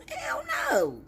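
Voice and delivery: a high squeaky hell no; incredulous. Real, not acted. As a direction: shoot the voice up in disbelief. Heated rant.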